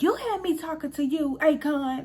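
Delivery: mild mock southern granny yell; high-pitched imitation of a scolding southern grandma; southern drawl yell. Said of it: "she got that southern drawl, so she really was yelling".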